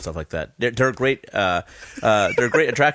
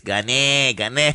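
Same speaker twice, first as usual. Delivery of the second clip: put-on Spanish accent; slower than usual. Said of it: "No, like this".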